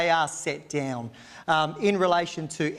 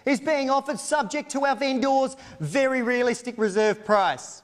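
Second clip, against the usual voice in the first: brisk, formal auction terms read aloud; auctioneer's formal read; 5 semitones higher than usual. Acted. Auctioneer reading contract terms to the crowd, formal and brisk. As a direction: announce the conditions of sale crisply to the crowd.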